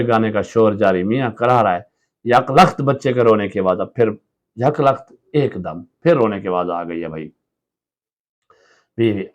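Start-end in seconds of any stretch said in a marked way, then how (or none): none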